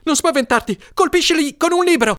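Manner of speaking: high-pitched urgent shout; Leslie yelling. A narrator's reading. Brother tells sister to hit the scorpions, yelling. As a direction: shout instructions over the panic. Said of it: "urlava Leslie".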